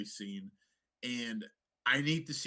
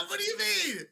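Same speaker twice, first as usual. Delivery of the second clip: laughing disbelief; 6 dB louder than usual. Real, not acted.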